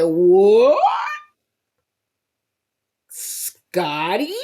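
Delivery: loud shocked gasp of disbelief; stunned, rising disbelief. Real, not acted. Shocked reaction to Scotty.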